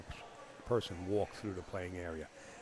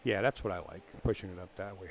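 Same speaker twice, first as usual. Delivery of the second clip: ordinary talk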